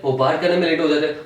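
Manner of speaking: ordinary talk, nothing marked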